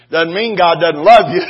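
ordinary talk, nothing marked

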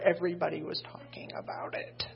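gossipy stage whisper; mock whisper. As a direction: hush it like a juicy secret. Mother's gossip fear, mock hush.

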